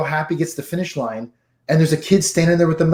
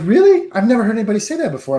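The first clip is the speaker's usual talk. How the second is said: put-on surprised girl; 6 semitones higher, faster than usual. Girl at Sheetz amazed by answer.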